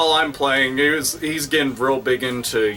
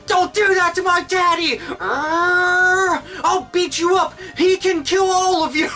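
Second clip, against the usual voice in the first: imitating a child's high, furious yell; protective little son; slower than usual. Son defends daddy from game enemies, mock high yelling. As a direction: squeal threats like a little kid. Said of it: "he'd be like".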